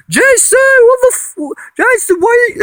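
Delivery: high-pitched retold scream at her son; mom screaming. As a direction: shriek his name in shock, way up high. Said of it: "my mom starts screaming at me".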